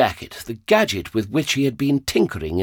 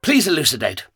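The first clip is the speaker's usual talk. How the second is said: snapped; 7 semitones higher than usual. A narrator's reading. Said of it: "the Doctor snapped".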